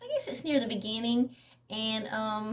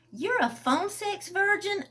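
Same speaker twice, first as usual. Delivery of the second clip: higher, slow, amused disbelief; teasing disbelief; 11 semitones higher, 3 dB louder, slower than usual. Acted. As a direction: tease him with mock shock.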